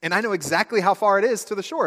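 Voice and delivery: excited friend shouting the facts; eager blurt. Acted. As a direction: blurt it out like the class show-off. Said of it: "And they blurt out".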